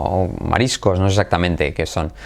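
normal talking voice

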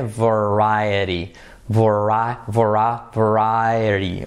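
variety, slow, with clear V; vari-, vari-, building up to variety. Shown as a demonstration. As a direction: break variety into parts, slowly. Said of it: "variety con V".

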